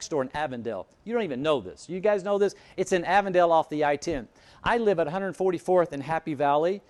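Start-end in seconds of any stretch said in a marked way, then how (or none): none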